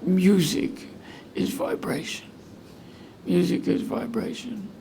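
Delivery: choked-up, breaking half-voice; emotional croak. Retold or imitated. Patient tearfully telling his surgeon about music, choked-up croak. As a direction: croak it out softly through tears. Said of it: "I croaked like Kermit".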